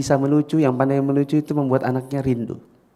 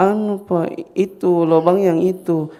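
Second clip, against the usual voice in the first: high, slow, timid hint; hesitant children; 7 semitones higher, slower than usual. Acted. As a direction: nudge your dad gently. Children hint to their father.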